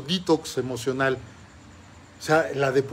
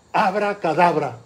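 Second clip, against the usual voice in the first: put-on boy calls out the spell loudly, higher; loud magic word; 4 semitones higher, 8 dB louder than usual. Boy shouting spell at the magic tree, loud.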